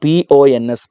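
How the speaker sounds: P O N S as separate letters, then the word; spelled letter by letter. Shown as a demonstration. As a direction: spell out P-O-N-S for the class. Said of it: "പേരാണ്".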